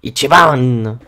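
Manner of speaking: a shouted Japanese catchphrase in a Japanese accent. Shown as a demonstration. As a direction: call out "Ichiban!" with a put-on Japanese accent. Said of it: "excusez-moi pour mon accent ... j'essaie un petit peu de mettre en japonais". (accent japonais)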